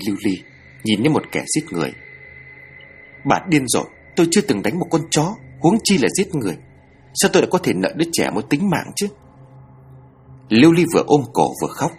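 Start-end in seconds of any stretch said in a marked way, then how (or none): 3.2-9.1 frantic woman yelling at a fortune teller she calls mad; crying yell. Acted. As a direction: scream at her through tears. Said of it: "hét vào mặt bà Đồng".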